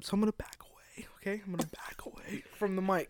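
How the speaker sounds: hushed, voiceless whisper into the mic. Pretend. Host jokes about backing away from the mic, whispering. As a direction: whisper it softly, drawing back from the mic. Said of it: "but whisper, apparently".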